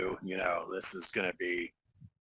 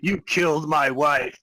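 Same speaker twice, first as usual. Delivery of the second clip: hammed-up vengeful hero, louder and higher, laughing through it; mock game hero threat; 3 semitones higher, 7 dB louder, slower than usual. Teasing his co-host's game line with a mock revenge roar. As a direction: playfully quote his dramatic revenge line. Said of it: "you kept being like".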